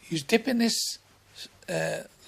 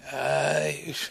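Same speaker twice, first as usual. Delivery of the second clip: normal talking voice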